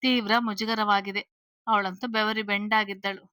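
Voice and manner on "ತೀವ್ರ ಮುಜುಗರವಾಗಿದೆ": ordinary delivery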